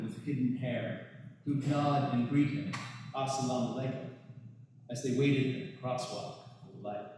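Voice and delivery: measured, deliberate poetry recital; poem reading. Acted. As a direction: read the poem line by line, with pauses. Reads own poem aloud.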